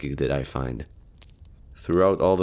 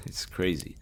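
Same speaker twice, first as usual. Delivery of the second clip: soft laugh; 9 dB quieter than usual. Acted. Musing on the silent night.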